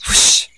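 a sharp, loud swishing whoosh of a bat. Acted. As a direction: blow out a fast bat swoosh. Bat swinging sound.

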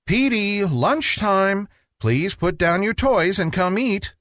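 a hint of a mother calling. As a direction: call him in for lunch. Mother calls child.

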